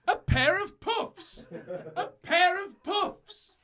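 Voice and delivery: high, slow mock yell of a park keeper; screaming park keeper. Park keeper yelling after fleeing lovers, high slow mock yell. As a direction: drag out each word like an outraged old keeper.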